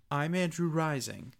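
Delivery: ordinary delivery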